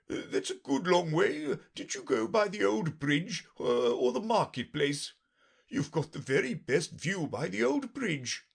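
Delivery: high, jittery king voice; a nervous king chattering high. Acted.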